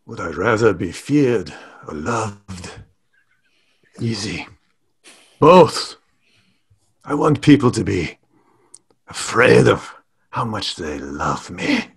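rough attempt at a French accent. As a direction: put on a slightly gangster French accent. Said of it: "you can do French if you want".

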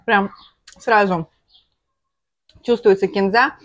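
speaking between bites; talking while chewing. Real, not acted. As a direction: mouth partly full. Seen 0: Ate food at -7s; lips pursed, chewing motions while speaking.

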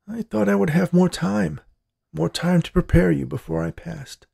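put-on high-pitched old man, regretful; regretful dying grandpa. A narrator's reading. Dying grandpa laments lost time to his granddaughter, regretful.

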